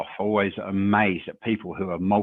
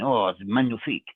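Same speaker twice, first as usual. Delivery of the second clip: lightly put-on French accent, savouring the word. Shown as a demonstration. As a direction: purr it like a charmed Frenchman. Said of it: "I can talk like this".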